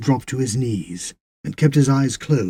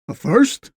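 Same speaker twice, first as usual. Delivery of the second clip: high, raised, questioning echo; puzzled query; 8 semitones higher, 6 dB louder than usual. A narrator's reading. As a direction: ask as if you misheard. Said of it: "not sure he'd heard right".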